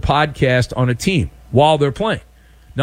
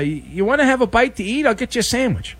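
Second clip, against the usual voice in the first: higher-pitched, casual lunch offer; higher voice, acted friendly offer; sheepish thief's offer; 6 semitones higher, faster than usual. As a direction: play the guy offering food to smooth things over. Acts out lawnmower thief offering food.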